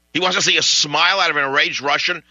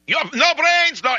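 put-on very high, louder Russian-accented shriek; Russian yelling; 4 dB louder than usual. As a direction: scream the insult in a Russian accent. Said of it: "he's screaming and yelling".

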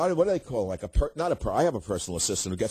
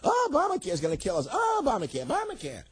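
put-on old lady complaining; 13 semitones higher than usual. Host mocks media as old ladies, high whiny wail. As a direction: wail it like a fussy old woman. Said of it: "complaining like old ladies".

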